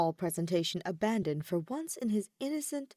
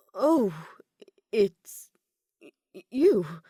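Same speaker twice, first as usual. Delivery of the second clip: louder, caught-off-guard stammer; startled stammer; 8 dB louder, much slower than usual. A narrator's reading. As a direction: blurt it out, thrown by the visitor. Disheveled noble opens door surprised.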